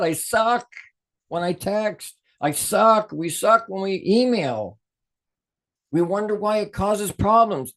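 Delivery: mock exasperation. Real, not acted. Teacher laughs at how bad his texts go over. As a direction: throw up your hands at yourself. Admits texting makes him suck.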